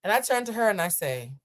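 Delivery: usual speaking voice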